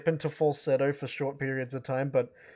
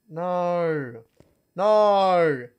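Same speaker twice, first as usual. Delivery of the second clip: escalating no; 4 semitones higher, 7 dB louder, much slower than usual. Real, not acted. Visuals suddenly glitching on stream.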